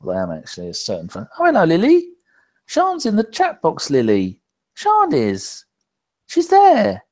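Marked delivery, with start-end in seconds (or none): 1.4-4.4 high, loud pet-talk voice; talking to the cat. Real, not acted. Host greeting his cat Lily in a high, loud pet voice. 4.8-7.0 high, loud pet-talk voice; talking to the cat. Real, not acted. Host greeting his cat Lily in a high, loud pet voice.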